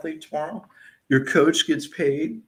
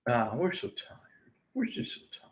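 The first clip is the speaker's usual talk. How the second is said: mock tired team; 2 semitones lower, 7 dB quieter than usual.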